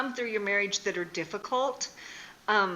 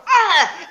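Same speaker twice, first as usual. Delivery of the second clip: much higher, much louder mock yelp of pain; 11 semitones higher, 15 dB louder than usual. Wife reliving grabbing the hot rock. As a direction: shriek as if the rock burns.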